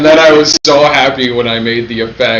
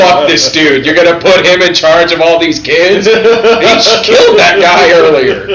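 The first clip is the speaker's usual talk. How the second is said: loud, laughing indignant rant; mock outrage; 5 semitones higher, 6 dB louder than usual. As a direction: rant at the character, half laughing. Mock outrage at movie character.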